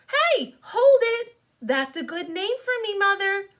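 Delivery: high, loud excited shout. Acted. Girl telling Mother her new clown name, excited. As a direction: burst out with a brand new idea. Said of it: "I clapped real excited".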